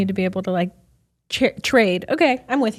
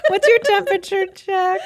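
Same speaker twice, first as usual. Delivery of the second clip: parroting the question through a laugh, higher; laughing mimicry; 9 semitones higher than usual. Friend echoing the host's question, laughing and higher. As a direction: echo her line while cracking up.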